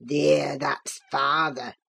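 put-on very low, slow gloating old man; low voiced old servant; crying out, old Joseph. A narrator's reading. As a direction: drawl it low and mocking. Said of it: "he cried".

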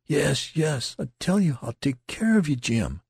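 high, urgent, emotional protest. A narrator's reading. Youth reassuring his dying friend, protesting. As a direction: insist on the promise, pitched high. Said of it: "protested the youth".

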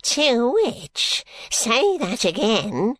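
put-on high, slow cracked cry of a deaf old miser; an old man straining to hear, shrill and sharp. A narrator's reading. Deaf old Smallweed demanding Bucket repeat himself, shrill and sharp. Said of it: "cried Mister Smallweed in a shrill, sharp voice".

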